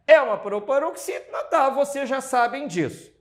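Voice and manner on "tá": ordinary talk, nothing marked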